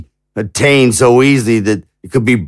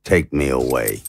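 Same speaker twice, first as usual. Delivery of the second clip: resigned surrender; 8 semitones lower, 7 dB quieter than usual. Acted. Surrendering to arrest.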